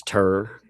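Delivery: tour said as "tur"; Southern tour. Shown as a demonstration. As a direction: make tour rhyme with fur, Southern style. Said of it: "that's how I say it".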